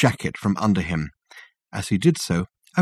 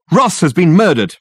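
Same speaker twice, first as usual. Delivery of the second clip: high, loud panicked shout; 9 semitones higher, 7 dB louder than usual. A narrator's reading. Richard bursting in to tell Dirk, shouting. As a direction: burst in yelling the news. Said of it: "he shouted".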